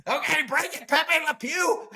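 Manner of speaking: high cartoon voice. Friends retelling a movie scene, high comic voice. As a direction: squeak it out like a cartoon character. Impersonating a character in movie quote.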